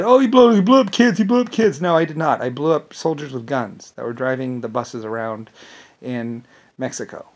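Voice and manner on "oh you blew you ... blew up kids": high, loud mocking accusation; mock accusers. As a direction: whine it like a shocked critic, pitched up. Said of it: "they might have gone and said".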